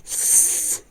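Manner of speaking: long hissing blow of smoke; acted sighing exhale. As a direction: blow out a huge mouthful of smoke. And he's like.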